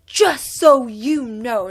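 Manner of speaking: loud, slow mock scolding; imitated screaming. School aide at the car window, imitated screaming. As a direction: imitate someone yelling, each word stretched and forceful. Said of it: "she's screaming at me".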